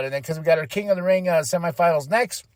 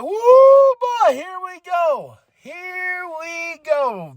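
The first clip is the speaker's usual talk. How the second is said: high, drawn-out fan whoop; hyped whooping; 3 dB louder, much slower than usual. Retold or imitated. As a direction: stretch it out high like a fan cheering. Hyped retelling of wrestler's entrance.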